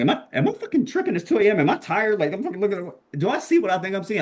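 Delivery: high-pitched, rushed self-questioning; retold shock, doubting your own eyes; emotional disbelief. Telling himself he's tripping at two A M, emotional disbelief. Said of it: "got really emotional".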